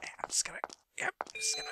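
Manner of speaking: hushed, breath-only whisper; furtive whisper. Real, not acted. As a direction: whisper it as if sneaking past. Sneaking past in game.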